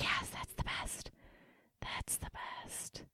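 soft breathy whisper, slowing at the end; whispered quote. Retold or imitated. Ty approving her outfit, in a whisper. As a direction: hush it like a secret compliment.

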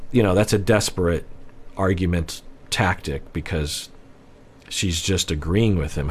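normal talking voice

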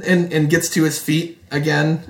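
normal talking voice

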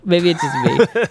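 laughing it off. Real, not acted. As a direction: chuckle through the words. Laughs at own quibble.

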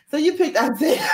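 laughing, teasing the guest over her pick. Real, not acted. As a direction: giggle while you say it. Repeats the question laughing.

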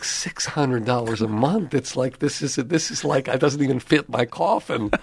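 can't believe what the office costs; incredulous. Retold or imitated. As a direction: repeat the rent in mock shock. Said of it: "I'm like".

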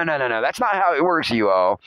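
ordinary delivery

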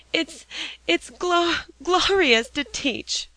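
high, slow, broken tearful words; mumbled through tears. A narrator's reading. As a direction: stammer it through the handkerchief. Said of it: "mumbled poor Alexia behind her wet handkerchief".

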